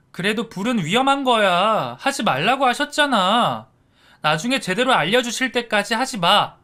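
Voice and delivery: high, loud nagging; scolding big sister. A narrator's reading. Sister scolding her brother for misbehaving, high and loud. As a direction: tell off your little brother.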